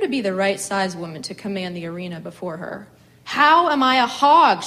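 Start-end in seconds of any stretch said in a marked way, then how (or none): 3.2-4.6 loud, higher, slow furious demand; angry demand. A narrator's reading. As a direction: demand an answer from the heavens. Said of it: "she demanded".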